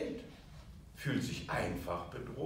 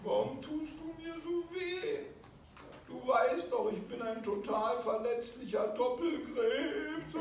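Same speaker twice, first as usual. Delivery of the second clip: put-on much higher, whiny child voice; hurt inner child; 10 semitones higher than usual. The inner child complains to the attacker in a high wounded voice. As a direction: plead like a wounded little child. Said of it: "Es sagt einfach".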